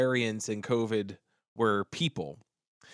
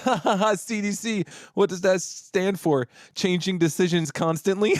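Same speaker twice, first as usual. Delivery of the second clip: high, jeering laugh into a taunting question; scoffing, laughed-out mockery, pitched up; mocking laughter; 7 semitones higher than usual. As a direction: imitate a group laughing in someone's face. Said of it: "they start laughing".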